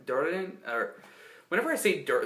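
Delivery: ordinary delivery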